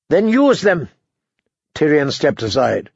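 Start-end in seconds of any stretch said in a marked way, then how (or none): 0.1-0.9 higher, impatient command; terse angry retort; angry order. A narrator's reading. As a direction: order him curtly, pitched up. Furious brother orders the other.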